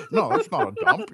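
ordinary talk

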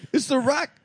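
high-pitched rapper catchphrase impression. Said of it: "such a good [name] impression".

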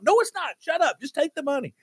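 high-pitched, flustered brush-off; acting out a panicked overpayer; frantic insisting. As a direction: cut off the protest in exasperation. Acted argument with the waiter.

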